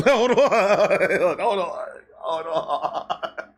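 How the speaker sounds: breaking into laughter; can't stop laughing. Real, not acted.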